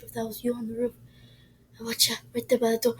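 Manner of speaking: normal talking voice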